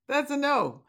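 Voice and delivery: laughing through the punchline; amused, laughing verdict. Real, not acted.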